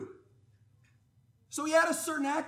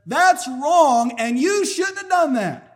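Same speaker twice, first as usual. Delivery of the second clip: blunt rebuke; 9 dB louder, slower than usual. Retold or imitated. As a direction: call the ruler out loudly to his face. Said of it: "he just simply said".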